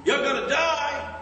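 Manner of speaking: high, slow, solemn preacher warning; pastor's warning. Retold or imitated. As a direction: stretch each die out slowly. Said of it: "he said".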